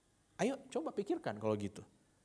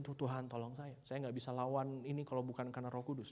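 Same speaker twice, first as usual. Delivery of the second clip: hushed, understated plea; soft retold prayer; 10 dB quieter than usual. Preacher recalls asking God for help with his child, soft plea. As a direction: pray it quietly, almost to yourself.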